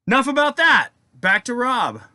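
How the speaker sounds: high, loud announcement; emphatic topic change. Real, not acted. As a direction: shake it off and call out brightly. Abruptly shakes off the sad topic.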